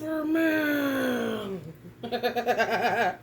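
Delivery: big shouting laugh. Real, not acted. Laughs after singing.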